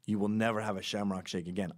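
ordinary talk, nothing marked

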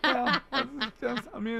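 ordinary talk

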